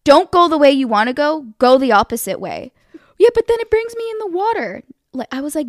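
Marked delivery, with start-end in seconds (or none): 0.0-2.7 loud, emphatic retold order; exasperated raised-voice instruction; frustrated. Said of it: "getting so frustrated". 3.2-4.8 very high, loud mock whine; hammed-up pitched-up complaint; protesting driver.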